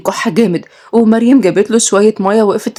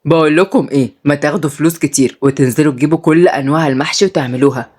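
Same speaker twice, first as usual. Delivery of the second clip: put-on lower, easygoing old man; old grandpa; 4 semitones lower than usual. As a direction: talk like a fond grandfather, low. Grandpa voiced.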